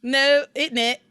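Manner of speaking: loud, drawn-out mock British; put-on British accent, stretched slowly; hammed-up British tag question. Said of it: "like, a British accent".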